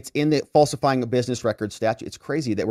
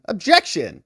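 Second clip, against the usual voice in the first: raised, sudden mock objection; higher-pitched acted interruption; courtroom objection; 6 semitones higher, 3 dB louder than usual. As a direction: call it out like a trial lawyer.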